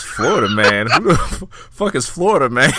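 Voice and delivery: puzzled yell of "who is that"; retelling a TV character's shocked question; incredulous outburst. Retold or imitated. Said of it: "He goes".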